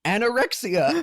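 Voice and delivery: high, hammed-up proclamation; resume the crank's list with mock drama, laugh close behind; Dr. Amp. Podcaster imitating the host resuming his list, theatrical. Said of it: "And then he goes back".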